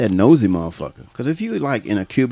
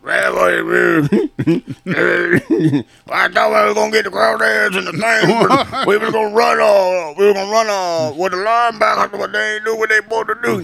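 LSU coach impression; 8 semitones higher than usual. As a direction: do the Cajun LSU coach, high and drawling. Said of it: "That's how the guy sound".

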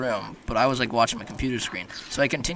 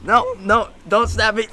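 panicked; 9 semitones higher, 7 dB louder, slower than usual. Pretend. Begging not to be stabbed.

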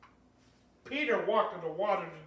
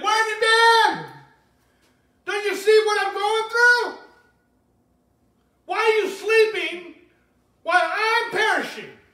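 high, loud, slow anguished cry; desperate complaint to God; 11 semitones higher, 12 dB louder than usual. Acted. Believer crying out at God, high and desperate shout. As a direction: shout it up at a God who seems asleep.

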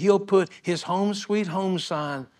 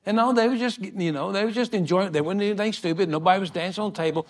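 ordinary delivery

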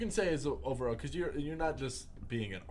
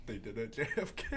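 laughing; 12 semitones higher, 4 dB quieter than usual. Real, not acted. Cracking up at their joke.

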